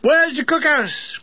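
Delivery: high, drawn-out call. A narrator's reading. Hungry soldier hollering for the cookhouse, calling out. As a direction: holler it slowly from afar.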